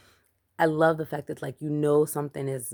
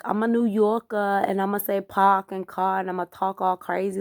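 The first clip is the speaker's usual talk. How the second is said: hammed-up New York accent, higher and louder; mock New York accent; 5 semitones higher, 3 dB louder than usual. Reviewer mocking movie New York accents, hammed-up New York accent. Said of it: "this crazy New Yorker accent".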